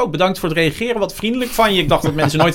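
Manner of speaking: high, gushing mock thanks; suddenly friendly. Angry commenter turns grateful after getting an answer, mock-sweet and high. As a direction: imitate a pleasantly surprised, sweet reply.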